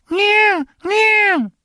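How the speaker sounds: very high, squeaky cat meow. Retold or imitated. Comic imitating his cat meowing at him. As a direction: meow like a small cat talking. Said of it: "Then he talks".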